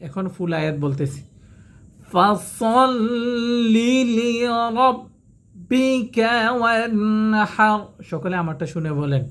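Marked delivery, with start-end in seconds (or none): 2.1-7.8 slow, chanted tajwid recitation; Quran recitation. Acted. Teacher recites the full surah for students to follow, chanted. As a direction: recite it slowly, melodic and held.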